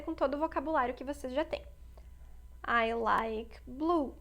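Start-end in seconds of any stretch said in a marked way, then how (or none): 2.7-4.2 slow, clear teacher's example; slow, deliberate classroom demo; slow model sentence. Shown as a demonstration. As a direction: lay the English out word by word. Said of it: "teacher models English sentence".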